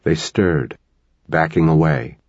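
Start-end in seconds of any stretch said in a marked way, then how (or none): none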